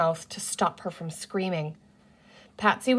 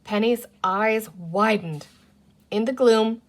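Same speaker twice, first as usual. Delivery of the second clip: ordinary delivery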